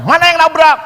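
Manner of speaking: high, loud gruff challenge; angry demand. Retold or imitated. Tattooed brother demanding who crashed, angry. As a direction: thug barking for the culprit.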